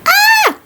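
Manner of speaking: a sudden very high exasperated squeal; exasperated squeal at the silly song; frustrated shriek. Real, not acted. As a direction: let out a high-pitched cry of frustration. Exasperated outburst after the parody.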